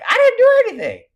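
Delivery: an indignant little squeal of innocence; Barbara protesting. Pretend. Barbara defending herself, squeaky and wounded. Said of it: "Barbara's like".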